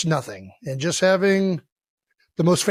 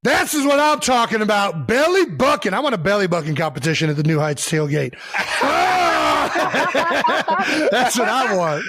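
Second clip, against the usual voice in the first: laughing shout. Real, not acted. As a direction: yell it out through real laughter. Excited by the belly bucking clip.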